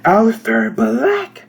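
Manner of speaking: shouted name that cracks high; voice cracking. Acted. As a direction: yell it and let the voice break. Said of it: "my voice cracked in the worst possible way".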